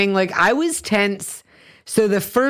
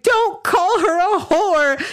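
put-on high, slow, whiny drunk girl voice; imitating a tipsy kid defending her new friend; drunk upset girl; 12 semitones higher, slower than usual. Drunk girl scolding the bellboy, high slow whine. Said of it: "she's like".

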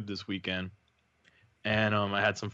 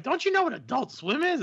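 high-pitched incredulous question; scolding friends. Retold or imitated. Friends challenge the host about his channel choice, imitated high incredulity. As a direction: imitate someone scolding a clueless pal. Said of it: "they're like".

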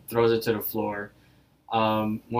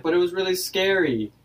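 higher-pitched, lightly acted protest; mock protest; 8 semitones higher than usual. Rigby insists his story was scary, high mock protest. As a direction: pipe up in protest, voice raised in pitch.

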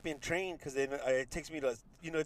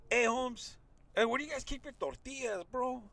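higher, softer, easy street-slang ask; 5 semitones higher, 6 dB quieter than usual. Shown as a demonstration. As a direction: talk to him like one of the homies. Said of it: "So you gotta do this".

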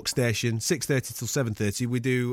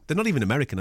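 ordinary talk, nothing marked

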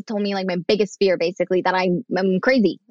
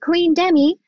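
servant voice; 6 semitones higher than usual. Retold or imitated. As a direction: play the dutiful servant announcing the bath, a bit high. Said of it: "she'd act like the servant".

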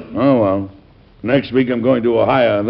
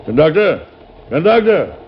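calling out; 5 semitones higher than usual. Acted. President calls for the conductor, rising shouts. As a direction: shout down the train car for him.

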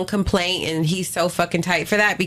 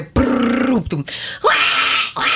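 drawn-out rolling trill, thud, then mock baby wail; imitated tumble and wail; 3 semitones higher, slower than usual. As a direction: mimic a fall downstairs and a crying baby. Mimics fall and baby crying.

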